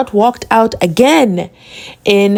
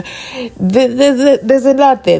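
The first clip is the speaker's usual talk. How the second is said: emphatic exclamation; 5 semitones higher than usual. Acted. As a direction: throw it out with emphasis, like a teaser. Repeats phrase, first emphatic.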